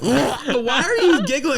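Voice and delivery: high, slow, laughing mock girlfriend voice; giggly girl voice. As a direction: squeak it out slowly through giggles. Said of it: "she'd be like".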